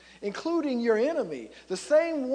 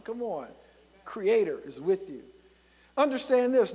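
ordinary talk, nothing marked

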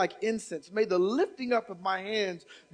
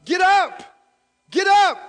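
rising shouts, each louder and higher; shouted command; 8 semitones higher, 9 dB louder than usual. Acted. Preacher as God, shouting the listeners up out of the grave. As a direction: bellow get up with mounting force. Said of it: "God is telling you".

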